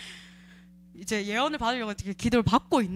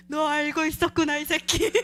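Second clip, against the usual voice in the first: laughing taunt; 6 semitones higher than usual. Real, not acted. Taunting the demon while laughing.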